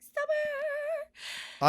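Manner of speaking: long high belted cry; High School Musical wail. Retold or imitated. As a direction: wail it like the movie scene. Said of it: "That scene from High School Musical where it's like".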